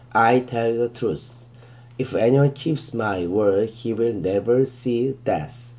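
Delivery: very slow, deliberate verse reading, word by word; slow scripture reading. Shown as a demonstration. Teacher reading the verse to students, very slow and deliberate. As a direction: read it out slowly so students can follow.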